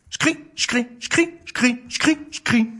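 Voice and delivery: hopping hare steps; light footsteps. Acted. Narrator voicing the hare's steps, high patter. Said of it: "entend des bruits de pas".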